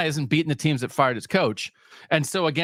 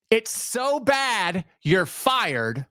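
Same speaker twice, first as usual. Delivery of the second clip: sarcastic drawl; 6 semitones higher, much slower than usual. Pretend. Mocking fans, drawn out.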